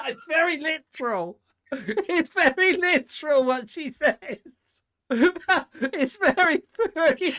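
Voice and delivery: high, helpless laughing speech; laughing hard. Real, not acted. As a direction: squeeze the words out through laughter. Co-host cracks up at the line.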